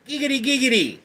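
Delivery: high, fast-rippling cartoon catchphrase; Quagmire. Streamer answering a chat request, high Quagmire impression. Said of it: "can you talk like Quagmire?".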